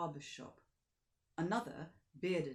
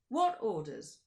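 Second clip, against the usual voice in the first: louder, clipped demand; sharp question; 3 semitones higher, 9 dB louder, slower than usual. Acted. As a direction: snap the question back. Count questions driver.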